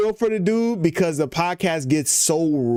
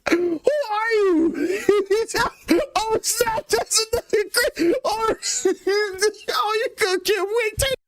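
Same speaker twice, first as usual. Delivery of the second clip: real high squealing laughter through the words; dying laughing, voice shot up very high; laughing hard; slower than usual. Real, not acted. Host cracking up on the podcast, laughing too hard to talk. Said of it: "that was a good laugh".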